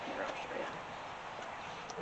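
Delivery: hushed so nobody wakes; soft whisper, slowed down. Real, not acted. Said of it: "I can't ring it 'cause everybody's sleeping".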